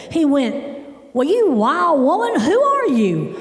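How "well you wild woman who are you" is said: high, stretched-out mock challenge; indignant outburst. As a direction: imitate an outraged dad, pitched up. Father's retold outburst at woman.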